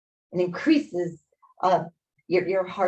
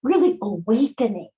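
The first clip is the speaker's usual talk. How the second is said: guided breathing; 4 semitones higher than usual. Acted. Yoga teacher guiding the class through a three-part breath, soothing.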